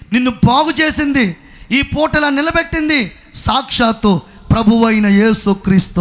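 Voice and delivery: normal talking voice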